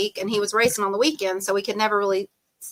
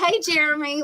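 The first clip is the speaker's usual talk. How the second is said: mock eager call; 6 semitones higher than usual. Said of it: "trying to get his attention".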